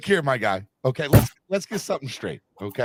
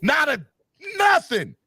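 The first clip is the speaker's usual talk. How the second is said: shouting; 8 semitones higher than usual. Real, not acted. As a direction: shout it out in disbelief. Ranting about Lakers loss.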